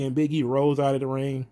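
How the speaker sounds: ordinary talk, nothing marked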